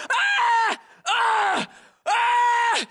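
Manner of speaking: short yells of pain; pained cries. Acted. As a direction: cry out as bullets hit. Bunker overrun.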